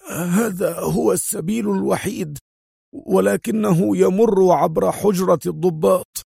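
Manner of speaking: high, strained, fearful voice; frightened inspector. Acted. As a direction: answer high and anxious, under threat. Frightened inspector answers his captor.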